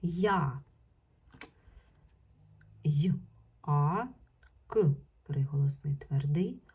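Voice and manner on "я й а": Я, then й and а held apart; sound-by-sound breakdown. Shown as a demonstration. Teacher showing pupils the sound scheme, sounding out. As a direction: split Я into й, а with clear pauses. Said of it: "буде звукова схема".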